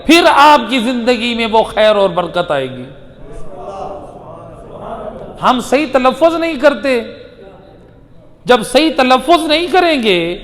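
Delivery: fervent pulpit cry; preaching at full force. Acted. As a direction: drive the point home to the congregation.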